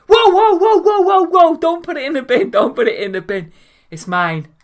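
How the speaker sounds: high, loud panicked whoa; panicked shout. Retold or imitated. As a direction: yell to stop her binning it. Panicked shout stopping mum.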